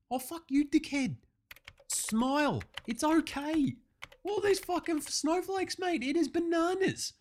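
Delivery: high, whiny despair; aggrieved high-pitched griping; exasperated. Acted. Whining at online critics.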